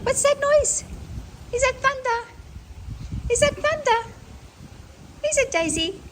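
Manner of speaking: very high sing-song pet voice; talking to a pet. Real, not acted. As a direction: coo at the dog in a high voice. Talking to frightened dogs.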